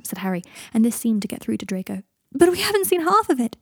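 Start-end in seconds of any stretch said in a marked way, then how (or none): 2.3-3.6 high, loud strained plea; taut with pain. Acted. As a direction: push the words out through pain. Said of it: "his voice taut with pain".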